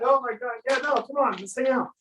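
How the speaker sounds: delighted customer. Retold or imitated. Customer laughing along, voiced.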